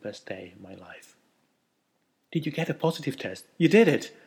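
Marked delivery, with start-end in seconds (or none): 2.3-4.2 high, loud, fast excited questions; thrilled. A narrator's reading. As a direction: blurt it out, can't contain the joy. Husband thrilled, asking about test.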